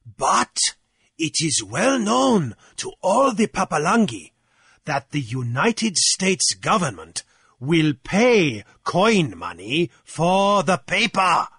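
slow, swooping high delivery; prompted objector. A narrator's reading. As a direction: raise an objection deliberately, word by word. Jeremiah counters after prompting.

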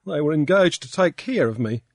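blubbering. A narrator's reading. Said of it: "Pankburn was blubbering".

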